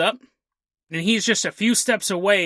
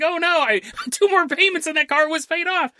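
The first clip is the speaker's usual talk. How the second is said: higher, laughing whine of a cartoon crook; mock villain whine; 8 semitones higher than usual. Cartoon kidnapper mourning his smashed car, laughing mock whine. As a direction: whimper about the car through giggles.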